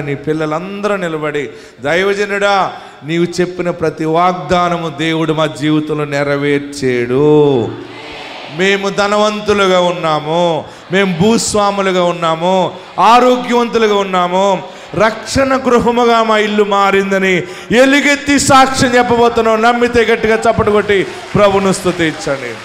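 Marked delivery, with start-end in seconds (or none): 1.8-19.6 slow and loud, climbing higher to the end; a family's triumphant cry, rising and slow; proud loud testimony. Pretend. Voicing the family's future testimony. 19.6-22.5 loud call to clap and praise; hall-filling preacher's call; preaching shout. Acted. Preacher urges applause.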